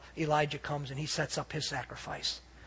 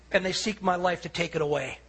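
ordinary talk, nothing marked

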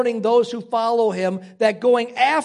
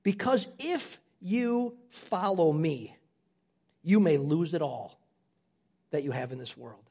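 hushed grave warning; 6 semitones lower, 5 dB quieter than usual. Acted. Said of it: "he would say it like this".

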